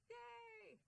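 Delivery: very high, held squeal of celebration; playful cheer. Pretend. Speaker celebrating after the mantra, high playful cheer. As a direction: let out a long high-pitched hooray.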